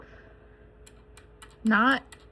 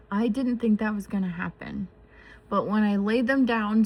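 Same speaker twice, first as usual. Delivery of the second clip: ordinary delivery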